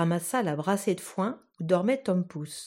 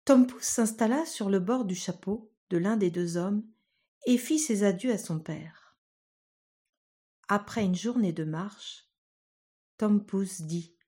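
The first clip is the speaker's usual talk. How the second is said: ordinary delivery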